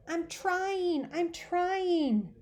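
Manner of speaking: put-on high child's voice, hollered; a little boy yelling back through the wall; child hollering. Little brother hollering back at Peter. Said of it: "Fudge hollered".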